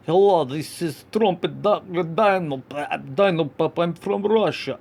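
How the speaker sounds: lightly put-on Russian accent; playful fake Russian accent; mock Russian accent. Shown as a demonstration. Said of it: "Russian would be more like, uh, like this".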